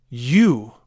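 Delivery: loud accusing growl; father growling at the neighbor, loud. A narrator's reading. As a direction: stab the word at him. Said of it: "I growled".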